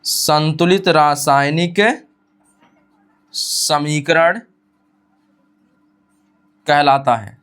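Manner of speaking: drawn-out, with long pauses between words; very slow dictation. Shown as a demonstration. Teacher dictating the final line very slowly. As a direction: stretch every word for copying. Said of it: "फिर सुनिएगा".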